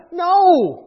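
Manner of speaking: loud, high, ringing no; emphatic refusal. Real, not acted. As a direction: shout it as the only possible answer. Answers own rhetorical question emphatically.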